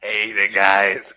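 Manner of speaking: slow, drawn-out streamer greeting; Slopes impression. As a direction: drag the greeting out lazily. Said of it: "favorite Evil voice".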